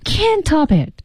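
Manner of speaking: "can't" drawn out and heavily stressed. Shown as a demonstration. Teacher showing students the accent in the line, heavy stress on "can't". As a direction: lean hard on "can't" in "can't top it". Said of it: "这个重音".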